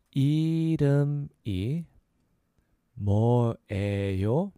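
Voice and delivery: 이름이 뭐예요 slow, each piece drawn out; slow syllable breakdown. Shown as a demonstration. Teacher repeating "what's your name", slow syllables. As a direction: stretch and separate 이름이 뭐예요. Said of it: "So a little faster".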